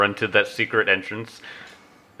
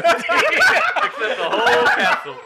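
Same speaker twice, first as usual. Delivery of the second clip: high, slow, laughing hard; slower than usual. Real, not acted. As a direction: squeal it through laughter. Group laughing at joke.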